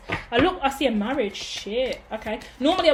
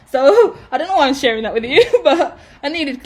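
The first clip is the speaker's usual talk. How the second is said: laughing; 4 semitones higher, 8 dB louder than usual. Real, not acted. Laughing at her own rant.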